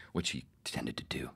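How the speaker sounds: whispered, teasing apology; whispered aside. Real, not acted. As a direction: drop to a sly whisper. Teasing aside about Rick.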